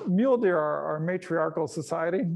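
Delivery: ordinary delivery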